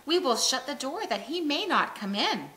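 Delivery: usual speaking voice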